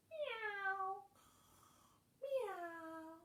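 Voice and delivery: high, mewing kitten voice; cat meow. Acted. Teacher meowing as the cat for the kids. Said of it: "she heard the cat go".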